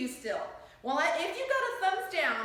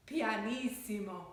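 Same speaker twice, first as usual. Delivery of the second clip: lower, softer and slow; tiny hushed voice; very soft pianissimo; 6 semitones lower, 6 dB quieter, slower than usual. Shown as a demonstration. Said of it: "Say this one".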